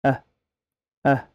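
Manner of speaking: ordinary delivery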